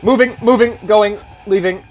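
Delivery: high frantic yelling; panicked. Real, not acted. Fleeing monster in game.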